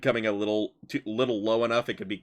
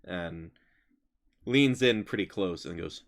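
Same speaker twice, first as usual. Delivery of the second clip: ordinary talk, nothing marked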